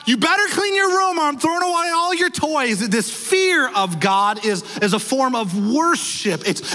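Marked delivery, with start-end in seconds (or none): 0.1-2.9 mock threat from a parent, pitched higher; scolding parent. Preacher mocking a parent's threat to a child, scolding. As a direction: imitate a parent threatening a kid over a messy room. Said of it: "this word fear is not".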